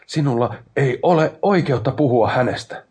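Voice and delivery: loud, high, heated rebuke; furious protest. A narrator's reading. Aikpod grabs his brother over his lost love, furious protest.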